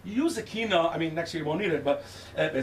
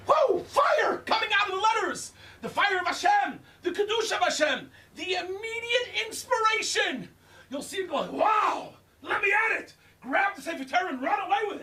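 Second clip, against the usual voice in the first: ecstatic outcry; 12 semitones higher than usual. Acted. Rabbi describing fiery Torah letters, ecstatic shouting. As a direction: burst out in wonder at fire from the letters.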